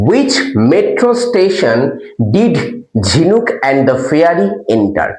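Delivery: slow, word by word dictation; careful classroom read; slow reading aloud. Shown as a demonstration. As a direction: read the question out slowly for the class. Teacher reads question slowly.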